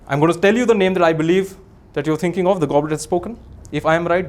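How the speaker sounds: ordinary talk, nothing marked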